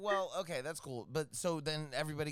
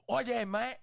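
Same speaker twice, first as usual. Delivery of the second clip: mock British Spanish; 5 semitones higher, 5 dB louder than usual. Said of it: "you speak Spanish like that".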